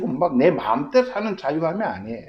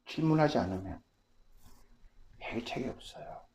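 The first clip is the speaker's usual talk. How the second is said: ordinary delivery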